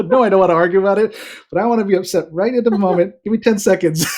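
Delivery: loud, high-pitched retold protest; mock upset. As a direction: playfully re-enact refusing to calm down. Acted upset reply to wife.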